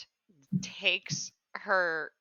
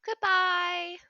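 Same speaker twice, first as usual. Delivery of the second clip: sweet character voice; 8 semitones higher, 5 dB louder than usual. Acted. Player waves off the cat.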